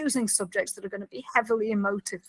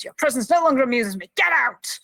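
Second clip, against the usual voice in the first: imitated angry dismissal, louder, rising at the end; angry, upset; 4 semitones higher, 6 dB louder than usual. Prisoner throwing Kira out, angry. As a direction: act out an irritated order to leave. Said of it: "he's angry and upset now".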